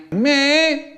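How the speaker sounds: very high, tiny squeak of Me?; weedy high squeak; weedy little voice. Retold or imitated. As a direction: squeak it out small and guilty. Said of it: "In a weedy little voice I say".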